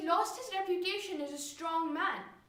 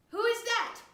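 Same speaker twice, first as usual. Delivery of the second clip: high-pitched, sharp challenge; demanding father; 8 semitones higher, 4 dB louder than usual. Acted. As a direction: demand to know who is there. Drunk father demands in the dark.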